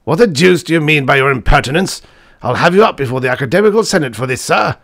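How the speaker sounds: loud, high indignant threat, rushing towards the end; peppery outburst. A narrator's reading. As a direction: round on the young man furiously. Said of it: "who was a peppery old fellow".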